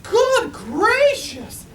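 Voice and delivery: very high, slow, drawn-out bird gasp; gasping Maisie. A narrator's reading. Maisie sees Horton in the circus tent, high slow gasp. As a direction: stretch the gasp out high and loud. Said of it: "gasped Maisie".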